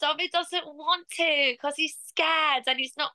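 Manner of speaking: put-on high, slow little-kid voice in a strong English accent; childhood English accent. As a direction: imitate a small English child, pitched right up. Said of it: "give you an idea of what I used to sound like".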